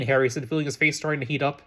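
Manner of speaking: plain reading voice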